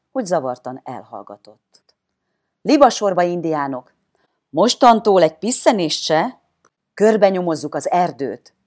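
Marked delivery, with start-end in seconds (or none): 2.6-8.5 loud, commanding orders; Bilibok Valentin shouting. A narrator's reading. Boy leader orders the gang into single file, shouting. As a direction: shout marching orders to the troop. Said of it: "kiáltotta Bilibok Valentin".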